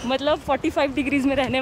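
muffled behind cloth mask; speaking while walking masked; through a face mask. Real, not acted. Seen 3: Cloth mask covers mouth and nose throughout; reference frames unmasked.

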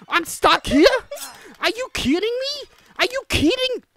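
high, loud outraged disbelief; stuck player yelling are you kidding; panicked disbelief. Real, not acted. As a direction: shriek in disbelief at being stuck. Stuck while chased.

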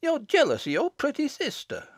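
high-pitched taunt; crying out in accusation. A narrator's reading. Nancy accusing Jennet of jealousy, crying out. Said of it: "cried Nancy Holt".

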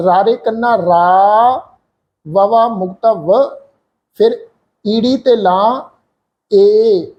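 slow, careful syllable-by-syllable spelling. Shown as a demonstration. Teacher slowly spells out the word for students. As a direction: spell ਰਾਰੇ ਕੰਨਾ ਰਾ slowly, letter by letter. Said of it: "ਕਿਵੇਂ ਹੋਵੇਗਾ ਜੀ?".

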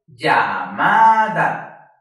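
"Llamada" very drawn out and loud, syllable by syllable; dividida por sílabas. Shown as a demonstration. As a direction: hold each syllable of "Llamada", swelling on "ma". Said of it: "Aquí dividimos llamada por sílabas". ("Llamada" muy lenta y fuerte, sílaba por sílaba)

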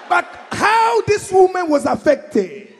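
high, loud, slow shouted build; shouted punchline. Acted. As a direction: crank it up to a laughing shout.

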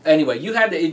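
normal talking voice

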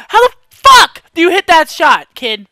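high, very loud outburst of disbelief; enraged yelling. Real, not acted. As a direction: yell it at the player who killed you. Rages at player who killed him.

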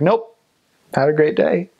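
high-pitched, singsong mock refusal; mock-polite dismissal, pitched well up; imitated breezy brush-off. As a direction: send them away with a lifted, put-on politeness. Acting out curt doorstep refusal.

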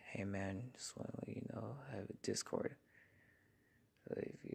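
very soft, hushed, fast reading; hushed to stay quiet. Real, not acted. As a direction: keep it down so nobody wakes. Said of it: "I'm talking like this 'cause I'm being as quiet as I can".

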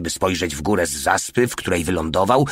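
plain reading voice

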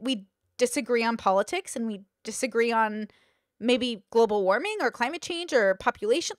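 usual speaking voice

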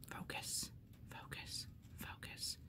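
barely audible whisper; whispered pleading. Real, not acted. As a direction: coax the camera under your breath. Pleading with the phone camera.

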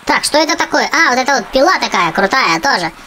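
normal talking voice

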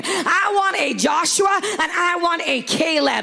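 rally the church like a battle cry; preaching at full force. Acted. Preacher urging congregation to take the land, full-force preaching.

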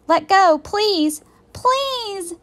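high, loud begging; pleading shout. A narrator's reading. Girl begs octopus to release the sub, pleading shout. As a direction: shout it high, stretching the last plea. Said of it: "Annie shouted at the octopus".

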